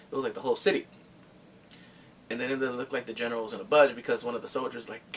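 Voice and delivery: ordinary talk, nothing marked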